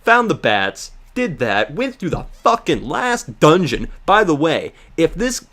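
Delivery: ordinary delivery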